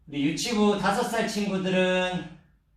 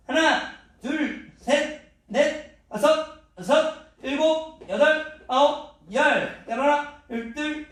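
shouted count while exercising; 8 semitones higher than usual. Acted. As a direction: count it out mid-workout.

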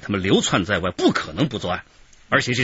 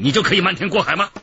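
sharp accusing challenge; 10 semitones higher, 5 dB louder, faster than usual. Acted. Interrogator confronts the suspect.

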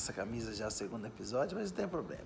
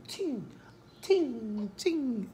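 high little tim tim tim sneezes; high squeaky fake sneezes; mock tiny sneeze; 10 semitones higher, much slower than usual. Shown as a demonstration. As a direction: imitate a weak dainty sneeze. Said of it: "o meu não é fraquinho assim".

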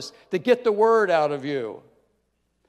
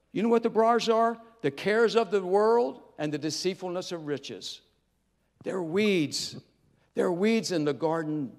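usual speaking voice

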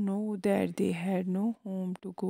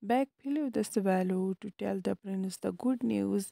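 plain reading voice

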